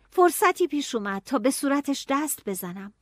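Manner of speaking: plain reading voice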